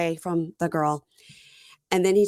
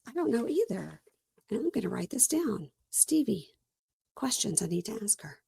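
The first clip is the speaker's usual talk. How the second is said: soft, high mock-secretive voice; hushed note-taking; 3 semitones higher, 7 dB quieter than usual. Host noting questions for Stevie, hushed. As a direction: keep it hushed, as if to yourself.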